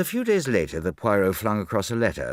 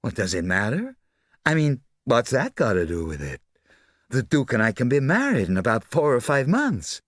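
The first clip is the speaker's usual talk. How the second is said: heedless, higher-pitched society lady; careless socialite; 4 semitones higher, 4 dB louder than usual. Acted. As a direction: wave the question away airily.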